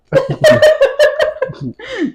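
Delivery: high giggling burst of laughter. Real, not acted. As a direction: laugh out before the confession. Shy laugh before confessing teasing.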